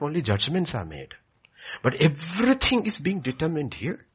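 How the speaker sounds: loud, high full-force sermon delivery; fervent preaching. Acted. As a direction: preach it out to the back pews. Preaching to congregation.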